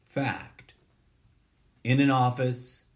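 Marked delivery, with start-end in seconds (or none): 0.0-0.7 whispered Fact; soft unvoiced whisper of Fact. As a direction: breathe the word Fact. as a whisper. Second opener, answering line 1.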